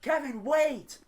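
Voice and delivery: high, loud shout imitating the band director; imitated urgent holler; retold yell. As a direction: yell it as if across a football field. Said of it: "He yells to me".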